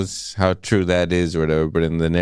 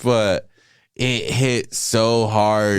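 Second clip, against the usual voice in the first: choked up; 5 semitones higher than usual. Real, not acted. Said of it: "getting me a little emotional".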